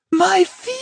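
extremely high, long agonized shriek; piercing scream of pain, held long; high thin scream. A narrator's reading. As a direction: scream it on one glass-shattering note. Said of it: "He screamed again, a high, incredibly thin note".

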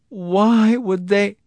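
high, slow, loud wail; tearful. A narrator's reading. Wife questioning her husband, tearfully bewildered. Sobbing wife asks for reasons.

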